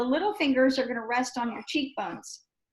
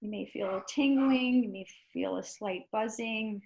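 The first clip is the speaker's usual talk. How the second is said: guided meditation; 5 dB quieter than usual. Acted. Guiding quiet after breathing.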